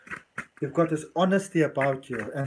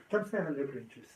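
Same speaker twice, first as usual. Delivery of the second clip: quiet coaxing; 4 semitones lower, 12 dB quieter than usual. Real, not acted.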